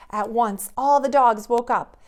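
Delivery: usual speaking voice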